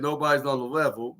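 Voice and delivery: ordinary delivery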